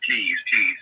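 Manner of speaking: usual speaking voice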